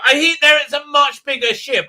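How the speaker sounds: high, loud exaggerated bafflement; mock alarm. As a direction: squeal it like a clueless character. Mock quote of baffled character.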